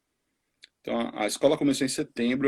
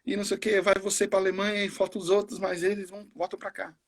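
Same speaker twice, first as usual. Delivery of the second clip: mock scolding aunt, much higher; imitating an upset aunt on the phone, pitched up; retold angry aunt; 9 semitones higher than usual. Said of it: "Aí ela ligou brava comigo". (tia brava imitada, bem mais aguda)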